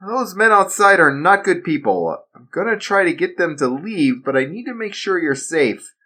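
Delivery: steady him like a worried dad. Acted. Father urging his son to remember the drill, earnest.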